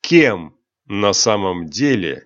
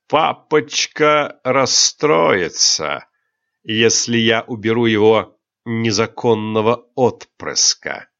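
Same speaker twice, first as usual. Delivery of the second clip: slow menace. Acted. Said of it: "медленно произнёс кронпринц".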